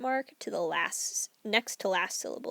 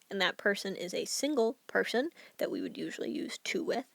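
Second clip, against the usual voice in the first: ordinary talk, nothing marked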